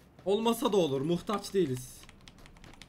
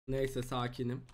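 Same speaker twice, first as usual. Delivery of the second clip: forced calm; 6 semitones lower, 8 dB quieter than usual. Real, not acted. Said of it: "Sakinim, şu an tepki vermemem lazım".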